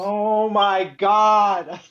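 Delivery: loud, slow exclamation; exclaiming. Real, not acted. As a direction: drag it out in disbelief. Reacting to a bad roll.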